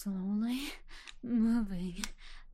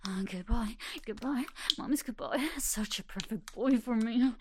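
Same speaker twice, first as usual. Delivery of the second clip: ordinary talk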